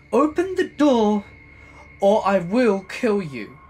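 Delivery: high, loud screaming threat, slowing down; furious screaming. Retold or imitated. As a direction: scream it with rage, dragging the end. Neighbor threatening through door.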